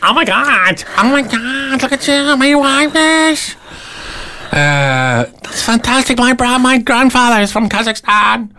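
high, gushing mock New York accent. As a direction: gush at a tourist like a chatty New Yorker. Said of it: "if it's a New York accent".

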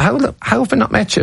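retold amazement, pitched high; incredulous question. Comic to an unknown newcomer he rates, retold incredulity. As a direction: ask in baffled disbelief, voice jumping up.

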